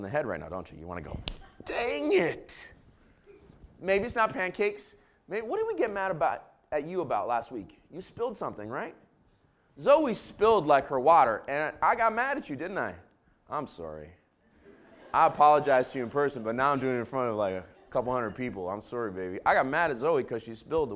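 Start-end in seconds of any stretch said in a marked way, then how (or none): 1.7-2.7 high, put-on "Dang it."; mock frustration. Pastor imitating a frustrated cook, high mock voice. 13.5-19.4 sincere apology. Real, not acted. Apologizing to daughter publicly.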